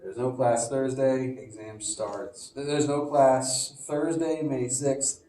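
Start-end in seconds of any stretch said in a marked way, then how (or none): none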